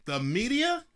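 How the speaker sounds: high, loud scoffing disbelief; mock the idea, voice jumping up; incredulous. Real, not acted. Speaker scoffing at viewers who trust the media, incredulous.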